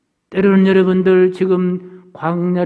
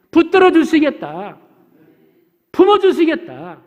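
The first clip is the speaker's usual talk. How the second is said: raised pitch, each promise falling away; emphatic preached promises; 6 semitones higher, 7 dB louder than usual. Real, not acted. Preacher promising congregation that God will protect them, emphatic. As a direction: proclaim each promise, high and insistent.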